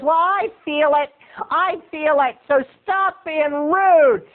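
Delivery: high mock-offended woman's voice; indignant moviegoer. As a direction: argue back as a huffy imitated woman. Acts woman arguing back.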